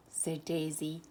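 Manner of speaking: ordinary delivery, nothing marked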